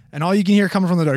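ordinary talk, nothing marked

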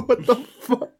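high-pitched laughing shriek of "What the fuck?"; squealing disbelief. Real, not acted.

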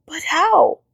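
high, urgent stage whisper. A narrator's reading. Rabbit asks Frog about the plan, whispering. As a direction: whisper back, high and breathy. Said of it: "Rabbit whispered back".

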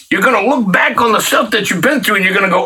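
usual speaking voice

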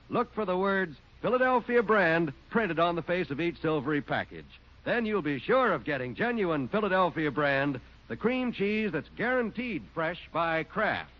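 ordinary delivery

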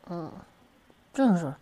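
ordinary delivery

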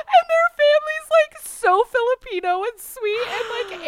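high, loud laughing cry; squealing through laughter; laughing shriek. Real, not acted. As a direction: still howling with laughter. Laughing hard at funeral story.

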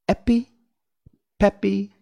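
high, loud incantation; reciting magic charm. A narrator's reading. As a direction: pronounce each magic word separately.

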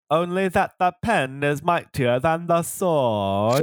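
slow, high, mock-grand declaiming; drawn-out thespian grandeur; theatrical proclamation. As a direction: proclaim it like a hammy stage actor. Mock-grand answer as joke.

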